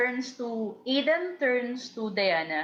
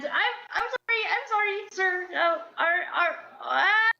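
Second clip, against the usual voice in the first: flustered apology; 9 semitones higher than usual. Acted. Diana flustered, starts crying.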